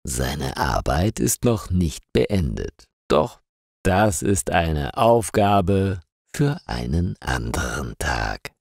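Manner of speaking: ordinary delivery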